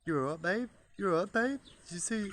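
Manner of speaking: high, loud caring bloke; lightly voiced rooster fussing over the hen; rooster boyfriend. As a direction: voice him checking on his girl. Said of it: "He's like".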